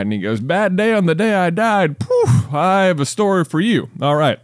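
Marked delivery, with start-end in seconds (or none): 0.4-2.0 eager to spring the punchline; high, bubbly third guy; excited third guy. Retold or imitated. 2.0-2.5 comic poof. Retold or imitated. Comic sound in voiced quote. 2.5-4.4 eager to spring the punchline; high, bubbly third guy; excited third guy. Retold or imitated.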